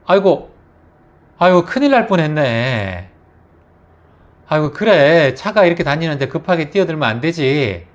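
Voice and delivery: loud relieved alarm. Shown as a demonstration. Passerby relieved the boy is safe, loud and kind. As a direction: exclaim how close the call was, then gently warn. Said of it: "이렇게 할 것 같아요".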